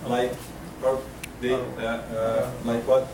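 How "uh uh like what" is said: ordinary delivery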